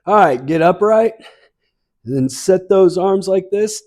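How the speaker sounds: bright, lifted workout cues over music; fitness instructor cueing. Real, not acted. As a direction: call the moves like an aerobics coach. Fitness class movement cues.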